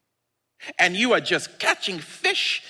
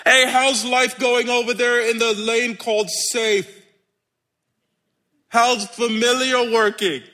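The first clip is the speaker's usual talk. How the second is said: high, sustained teasing call; 4 semitones higher than usual. Acted. Jesus needling those who play safe, calling out. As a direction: call over to the other side.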